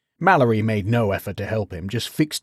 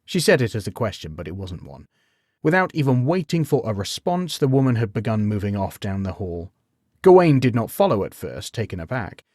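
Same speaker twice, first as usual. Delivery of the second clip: ordinary delivery, nothing marked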